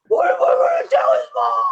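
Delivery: very high, loud whispered panic; panicked friend. Retold or imitated. Friend panicking to the nurse, high squeal. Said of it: "He's like".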